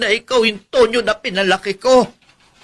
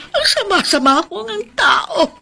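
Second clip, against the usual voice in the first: tearful; 8 semitones higher than usual. Acted. Aunt upset, feels blamed.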